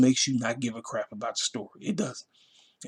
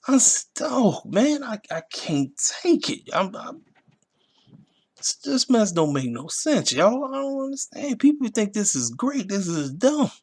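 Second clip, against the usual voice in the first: sarcastic high whine; 9 semitones higher, slower than usual. Acted.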